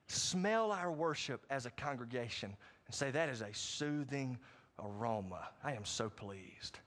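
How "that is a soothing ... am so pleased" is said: low, slow, soft and content; pleased God. Acted. God smelling the worship, low, slow and pleased. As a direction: savour it slowly.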